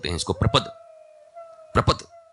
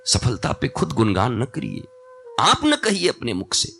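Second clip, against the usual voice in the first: ordinary talk, nothing marked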